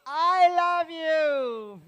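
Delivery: very slow and loud, each word stretched; slow loving demo. Shown as a demonstration. Storyteller slowly saying it aloud for children to guess. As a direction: spell it out tenderly and loudly for the kids.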